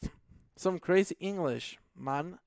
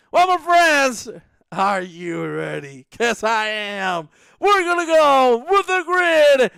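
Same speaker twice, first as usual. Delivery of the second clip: loud, high race-start hype; rally the crowd for the start; big punchy commentator call; hyped-up announcer; 8 semitones higher, 10 dB louder, slower than usual. Real, not acted.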